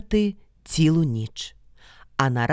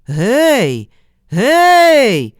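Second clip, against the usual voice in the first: loud answering shout, second one higher; calling back; 6 semitones higher, 9 dB louder than usual. A narrator's reading. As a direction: holler back into the forest. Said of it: "Микола знову".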